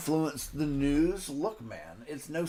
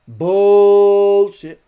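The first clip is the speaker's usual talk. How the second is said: loud, furious bark of a word; angry shout; 4 semitones higher, 15 dB louder than usual. Real, not acted. Pundit shouting down the claim, furious and loud. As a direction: blast it out in disgust.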